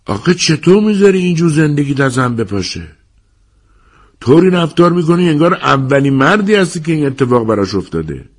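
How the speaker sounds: high-pitched scolding plea; exasperated Hat. A narrator's reading. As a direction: remonstrate with a friend, pitched well up. Narrator voices Hat scolding Edward. (سرزنش دوستانه با صدای زیر)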